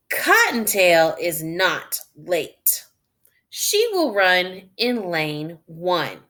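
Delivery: slow, clear read-aloud; reading to children. Acted. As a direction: read slowly for beginning readers. Reading story text aloud.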